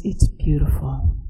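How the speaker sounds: put-on soft, breathy Russian-accented whisper. Lindy voicing his doubt about beauty, whispered. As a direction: let the wonder fall to a breath.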